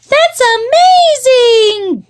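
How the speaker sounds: high, loud, drawn-out gush; gushing girls. A narrator's reading. Girls admiring their brother's tricks, gushing high and loud. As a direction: squeal it like awestruck little girls. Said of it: "gushed the girls".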